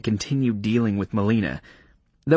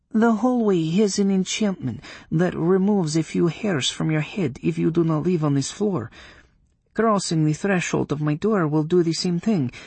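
high, reluctant witch voice; grudging witch forced to confess; 8 semitones higher than usual. Acted.

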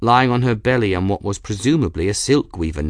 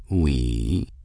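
low, smug query; smug cat; 8 semitones lower than usual. A narrator's reading. Raoul needling Bridget about her plan, smug. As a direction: drop low and ask it smugly. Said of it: "Raoul asked smugly".

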